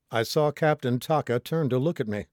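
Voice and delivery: plain reading voice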